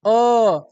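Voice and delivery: very slow held ओ; central vowel demo. Shown as a demonstration. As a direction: stretch ओ long and steady. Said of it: "मध्य से निकल रहा है". (धीमा खिंचा हुआ ओ)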